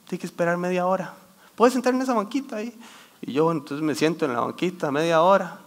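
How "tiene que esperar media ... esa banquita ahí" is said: higher-pitched imitation of a lady at a counter; lightly voiced clerk lady. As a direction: lightly mimic a woman breaking unwelcome news. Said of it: "y me dice la señora".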